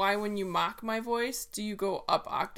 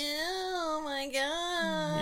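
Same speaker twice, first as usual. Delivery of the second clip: mock nasal whine; high nasal put-on voice; 6 semitones higher, much slower than usual. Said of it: "not so nasally".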